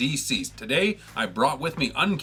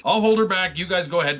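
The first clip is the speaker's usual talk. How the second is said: loud, raised battle command; heroic call-out; 7 semitones higher, 6 dB louder than usual. Acted. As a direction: call over the fight to your team. Captain Cold shouting amid battle.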